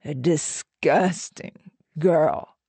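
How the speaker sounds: slow, savoured insult; relishing drawl; taunting repeat. A narrator's reading. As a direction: dwell on each word, drawn out. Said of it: "Otto repeated".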